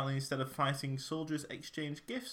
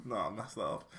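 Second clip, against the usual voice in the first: ordinary talk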